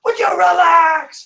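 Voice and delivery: high, loud, slow yell; screaming parent. Retold or imitated. Parent screaming at kids to relax, high slow yell. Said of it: "parents who scream at their kids".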